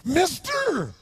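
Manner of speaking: put-on high, loud strange drunk's cry; high, loud odd voice of the drunk; bizarre voice. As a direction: mimic a bizarre drunk calling out. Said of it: "He had this really bizarre looking sounding voice".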